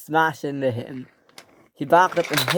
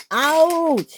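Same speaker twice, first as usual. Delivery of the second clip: sharp squeaky ouch from a hit engine; high pained ouch; high yelp; 13 semitones higher, 4 dB louder than usual. Acted.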